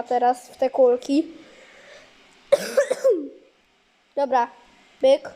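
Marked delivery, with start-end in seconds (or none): 2.5-3.3 chesty cough. Real, not acted. Coughing while recording.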